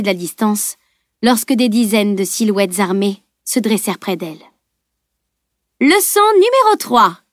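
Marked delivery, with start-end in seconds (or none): 5.8-7.2 high-pitched proclamation; called out. A narrator's reading. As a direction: throw it out like a taunting lesson. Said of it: "lança la dessinatrice". (lancé d'une voix haut perchée)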